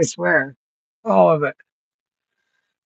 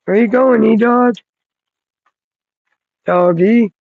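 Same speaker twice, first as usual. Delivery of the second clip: higher, louder doggy voice; pet talk; 4 semitones higher, 4 dB louder than usual. Real, not acted. Calling the wandering dog, a high pet voice. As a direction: coax the dog back with a high call.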